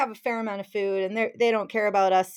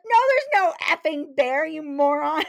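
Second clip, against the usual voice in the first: loud, higher furious scolding; furious retort; 5 semitones higher, 5 dB louder than usual. Retold or imitated. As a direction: chew him out, fuming. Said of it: "And she's furious".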